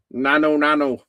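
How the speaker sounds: light alien catchphrase voice; quirky alien sitcom greeting; Mork greeting. Retold or imitated. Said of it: "Or the Mork and Mindy egg".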